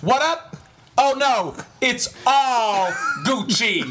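high, goofy clowning character voice. Retold or imitated. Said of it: "he has a video of him dressed up in some kind of costume".

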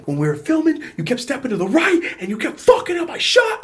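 high, loud imitation of a screaming rant; retold screaming. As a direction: scream it like the man losing it. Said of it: "starts screaming at the top of his lungs".

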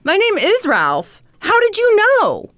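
high-pitched, hammed-up astonishment; amazed excitement. Ralph to the psychic stranger who knew his name, mock amazement. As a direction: play him giddy and amazed, voice way up.

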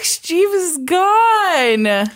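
high, drawn-out wail of loss; mock lament. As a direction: mourn it with exaggerated grief. Mock mourning a dead website.

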